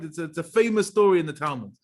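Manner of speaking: ordinary delivery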